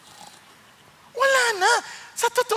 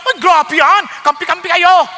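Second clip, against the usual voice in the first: loud, raised-pitch protest; mock angry objection; 5 semitones higher, 8 dB louder than usual. Preacher acts a listener objecting to "wives, submit", loud and indignant. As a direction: play the offended listener, loudly. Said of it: "Yung iba nagagalit agad".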